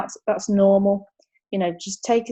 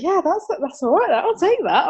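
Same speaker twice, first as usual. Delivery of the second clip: high, sing-song retold self-congratulation; high-pitched mock-smug satisfaction; chuffed with herself; 11 semitones higher, 4 dB louder than usual. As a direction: play up being pleased with yourself. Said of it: "I was quite chuffed with myself".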